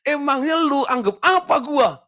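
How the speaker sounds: high, indignant husband's retort; offended husband. Retold or imitated. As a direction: snap back like a hurt husband. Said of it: "Lalu suaminya bilang gitu". (suami tersinggung, nada tinggi)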